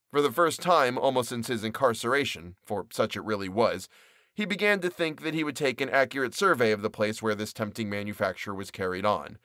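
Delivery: ordinary delivery, nothing marked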